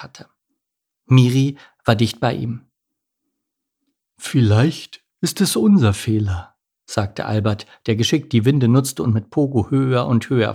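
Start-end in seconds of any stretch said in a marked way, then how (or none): 4.2-6.6 slow, slightly altered character voice; a hint of a ponderous albatross; lightly voiced albatross. Albatross gently consoles the penguin.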